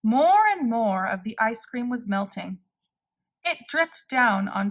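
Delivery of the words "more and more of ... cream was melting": ordinary delivery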